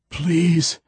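"Please," as a strained desperate plea; begging. Acted. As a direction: beg with everything left. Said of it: "begged Hoyt".

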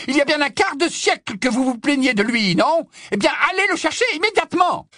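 high-pitched scolding, speeding up at the end; exasperated yell. Acted. Professor scolding the caretaker about Peeves, yelling. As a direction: rail at him, then rush the order.

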